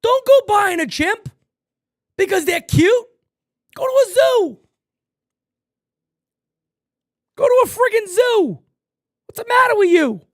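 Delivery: high, loud exasperated scolding; exasperated rant. Real, not acted. Streamer scolding people who buy pet chimps, loud and exasperated.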